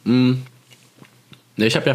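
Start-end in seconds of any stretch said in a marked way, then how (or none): 0.0-0.3 kauend sprechen; mit vollem Mund. Real, not acted. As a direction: beim Schokoladeessen reden. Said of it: "Ich esse grad Schokolade". (mit Schokolade im Mund sprechen)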